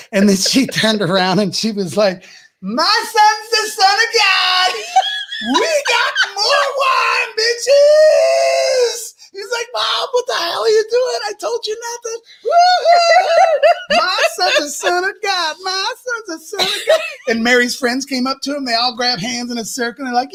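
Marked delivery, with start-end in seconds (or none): 2.6-9.1 triumphant shout. Acted. Mary shouting to the wedding crowd, high drunken crowing. 9.7-12.2 high, strained whine at mom; Jesus scolding his drunk mother, high and strained; exasperated son. Acted. 12.4-17.0 high, laughing, cackling celebration; drunken whoop. Acted. Mary celebrating her son to the guests, high laughing whoop.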